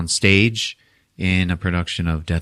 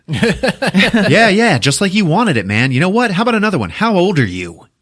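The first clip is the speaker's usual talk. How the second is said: high-pitched, heated challenge; mock taunting; 11 semitones higher, 4 dB louder than usual. [name] grills Tommy on camera about his age, mock taunting. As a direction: act out needling someone with laughter underneath.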